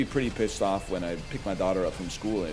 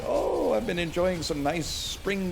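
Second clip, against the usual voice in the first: higher, slow, sugary mock quote; mocking singsong; 7 semitones higher, slower than usual. Streamer mocking a chat member's cheerful remark, singsong. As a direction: mimic him dreamily. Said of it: "and you're like".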